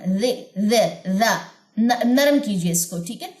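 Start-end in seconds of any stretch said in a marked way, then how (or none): none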